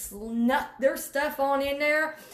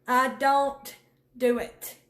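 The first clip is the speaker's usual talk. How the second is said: normal talking voice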